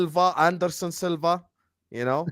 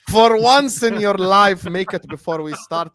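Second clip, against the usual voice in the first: louder, slow exasperated plea to the tea-maker; pleading; 4 semitones higher, 6 dB louder, slower than usual. Real, not acted. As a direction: beg him slowly and emphatically. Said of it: "I beg you".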